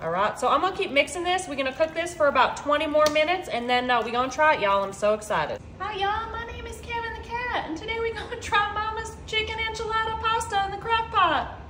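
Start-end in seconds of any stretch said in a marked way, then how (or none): 5.8-11.6 cat voice. Pretend. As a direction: speak as the cat, high and sweet. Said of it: "do you think that's how Kevin would talk".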